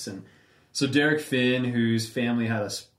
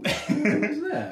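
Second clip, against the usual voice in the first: laughing; slower than usual. Real, not acted. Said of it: "trying to recall the song".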